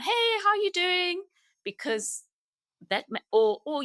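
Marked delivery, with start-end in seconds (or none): 0.0-1.2 high-pitched mock breezy hello; flippant greeting. The greeting a bereaved mother doesn't want, flippant. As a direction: parody a careless greeting, pitched way up. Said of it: "You don't want the flippant words".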